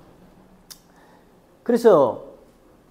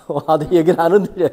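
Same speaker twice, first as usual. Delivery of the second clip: laughing; 6 dB louder, faster than usual. Real, not acted. Joking about earthquakes.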